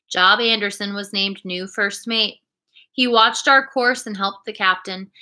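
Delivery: plain reading voice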